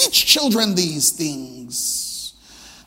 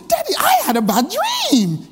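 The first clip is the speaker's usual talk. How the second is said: put-on much higher, beaming little girl; excited child; 13 semitones higher than usual. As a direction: say it grinning like a proud kid. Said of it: "big smile, excited".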